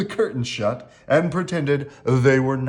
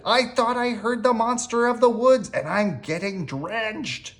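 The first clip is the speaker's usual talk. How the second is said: lightly voiced rabbit, pitched up; a hint of an urgent rabbit, higher voice; calling Rabbit; 9 semitones higher than usual. A narrator's reading. Rabbit calls through Owl's door in the rain, lightly voiced urgent call. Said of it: "called Rabbit".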